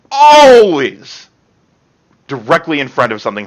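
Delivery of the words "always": much higher, loud dragged cry; louder and higher repeat. Acted. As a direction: echo it as rising panic. Dramatic reading before horror.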